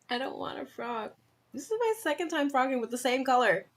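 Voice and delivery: playful whine. Real, not acted. Whining about frogging again.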